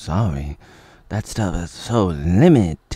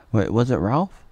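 ordinary delivery

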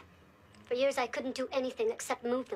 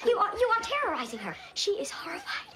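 high, raised outraged objection; upset protest; 9 semitones higher, 3 dB louder than usual. Acted. Woman accuses therapist of terrorizing the girl, upset protest. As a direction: protest sharply on the child's behalf.

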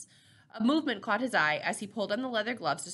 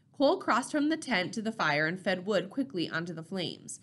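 plain reading voice

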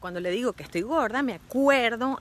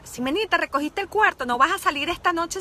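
mother scolding; 5 semitones higher, 5 dB louder than usual. Retold or imitated. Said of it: "Otro T V T clásico de Simenita".

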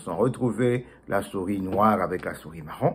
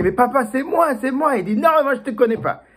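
pleading exchange; 13 semitones higher, 5 dB louder than usual. Acted. Son pleads, father refuses.

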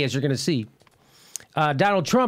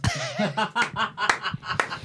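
chuckling; 3 semitones higher, 5 dB quieter than usual. Real, not acted. Host enjoying the Lincoln joke, chuckling. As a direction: run of laughter bursts.

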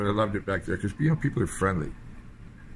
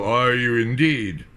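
slow, louder, arch and unimpressed; slow sarcastic comeback; dry mock reply; 2 semitones higher, 6 dB louder, much slower than usual. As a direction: draw it out like a smug commander.

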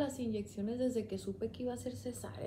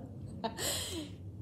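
high, soft laugh; amused laugh; 11 semitones higher, 6 dB quieter than usual. Real, not acted. Recalling asking doctors for coffee, a soft amused laugh. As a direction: laugh at your own silliness.

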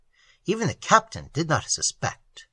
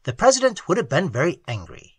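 ordinary delivery, nothing marked

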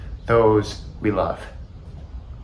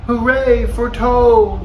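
high, loud, drawn-out cheer; cheering shout; 12 semitones higher, 6 dB louder than usual. A narrator's reading. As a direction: cheer slowly for the star on stage. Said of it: "as he shouted".

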